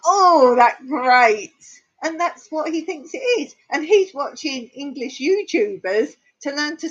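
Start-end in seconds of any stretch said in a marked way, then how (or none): 0.1-1.5 mock exasperation. Teacher reacting to the YouTuber's English, mock exasperation. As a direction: exclaim it loudly, as if it grates. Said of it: "And I was like".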